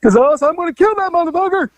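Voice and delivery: high, raging threat; enraged woman. Retold or imitated. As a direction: threaten him with the shotgun. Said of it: "she goes".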